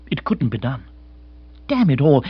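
ordinary delivery, nothing marked

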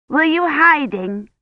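put-on high, slow little-girl question; Agnes, curious child.

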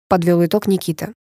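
usual speaking voice

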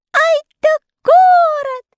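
high, slow tiny-voiced delight; delighted exclaim. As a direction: gasp at the city in wonder. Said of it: "восклицали малютки о восхищении". (восторженно)

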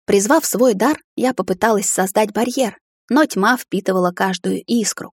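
usual speaking voice

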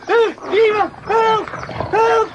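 high, loud cries for help; desperate loud cry for help; calling for help. Acted. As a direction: yell for help in panic. Steward attacked, crying for help.

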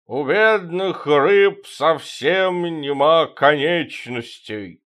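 put-on slow slurred drunken drawl; town drunk. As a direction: drone like a drunk at the table. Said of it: "Васильев басом и пьяно". (пьяный бас)

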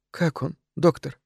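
soft, slow, anxious question; subdued wife's inquiry; hushed worry. A narrator's reading. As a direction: ask quietly, afraid of the answer. Worried wife quietly asks the doctor.

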